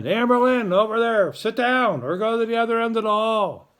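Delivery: high, loud hallway holler; teasing teacher ordering a student off; mock bossy call. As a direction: call a student out across the hall. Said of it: "I miss bugging Amberlynn".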